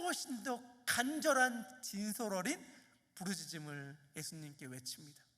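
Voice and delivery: usual speaking voice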